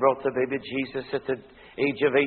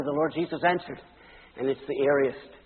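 ordinary talk

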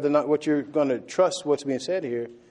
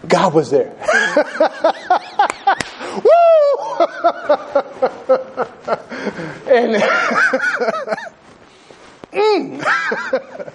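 laughing; 13 semitones higher, much slower than usual. Real, not acted. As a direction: overcome with laughter mid-sermon. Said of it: "I can't even talk".

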